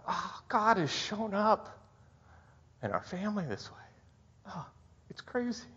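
high, softened, choked-up awe; real tearful wonder; emotional. Real, not acted. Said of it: "I got all emotional".

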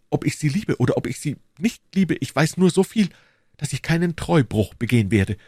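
pleading, torn, with pitch leaps; distressed queen. Acted. Queen begging the duke to leave, anguished. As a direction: implore with swelling and sinking agitation.

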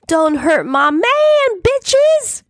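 high, drawn-out shriek; frantic protective scream, climbing very high. A narrator's reading. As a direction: scream it out the door. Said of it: "screaming out the door".

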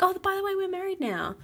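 high, breezy offhand voice; casual mock quote. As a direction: toss it off like it's nothing. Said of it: "They're like".

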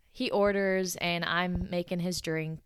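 plain reading voice

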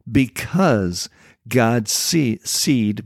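"because" drawn out slowly and leaned on; slow down and press hard on "because"; stress on because. Shown as a demonstration. Preacher to listeners, leaning slowly on "because". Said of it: "I want to emphasize the word because".

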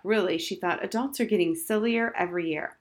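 plain reading voice

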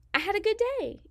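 "good" sung out high with a falling finish; happy lift. Real, not acted. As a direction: brighten up on "I had a good day". Happy punchline about her passion.

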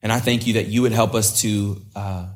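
gentle spoken prayer. Acted. Opening prayer.

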